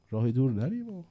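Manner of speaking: ordinary talk, nothing marked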